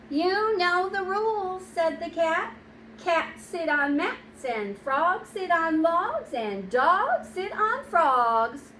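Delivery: slow, sing-song cat reciting rules; a know-it-all cat lecturing slowly; prim cat. Acted. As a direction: lay down the law like a fussy cat.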